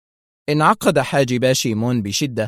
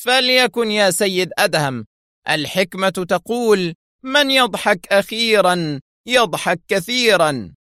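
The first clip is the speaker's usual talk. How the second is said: provoked threat; 8 semitones higher than usual. A narrator's reading. Shimon warns Adham with a proverb, provoked. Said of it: "فانتفض جسد شيمون وهو يقول".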